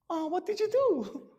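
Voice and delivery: put-on high, soft and slow girly question; girl voice. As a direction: ask it slowly, sweet and curious. Girl asks Kyle.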